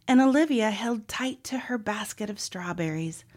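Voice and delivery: ordinary delivery, nothing marked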